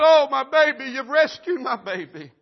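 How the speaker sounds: high, loud cry of relief; overwhelmed, hammed-up grateful outcry; weeping mother, acted. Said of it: "she is weeping and crying and said".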